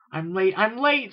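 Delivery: high, loud, drawn-out wail; groaning in dismay. A narrator's reading. Filly galloping to the library, groaning that she's late. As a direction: stretch it into a high fretful complaint. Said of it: "Sweetie Belle groaned as she galloped".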